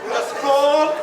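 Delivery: high, slow ad voice; mock commercial. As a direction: sell it like a cheesy ad. Mock ad jingle for Ali.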